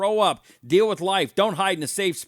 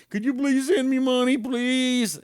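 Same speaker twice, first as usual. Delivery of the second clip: mock begging; 10 semitones higher than usual. Mocking beggars asking for money.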